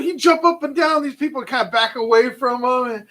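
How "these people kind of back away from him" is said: ordinary talk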